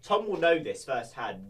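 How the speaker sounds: usual speaking voice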